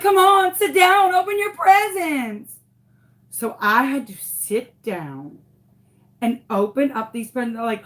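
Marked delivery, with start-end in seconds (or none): 0.0-2.6 high, loud, eager party voice; gushing coworkers. Retold or imitated. Coworkers pushing the guest of honor to open gifts, high and eager. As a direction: coworkers herding the teacher to her gifts, gushing.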